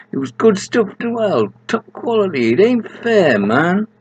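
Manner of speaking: high indignant character voice; high Gabe voice. Acted. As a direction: play Gabe high and put out. Gabe voiced in read story.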